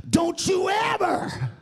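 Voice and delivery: high, drawn-out scolding cry; stern rebuke. Retold or imitated. The Lord rebukes the judging preacher, stern and high. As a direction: cut them off with a long, high warning.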